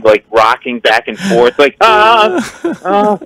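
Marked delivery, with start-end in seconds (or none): 1.8-3.2 high strangled "ah, ah" sounds; nervous high whimpers. Retold or imitated. Starstruck comic meeting [name], making a nervous high whimper. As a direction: whimper high like you're losing it. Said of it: "suddenly started to make this voice".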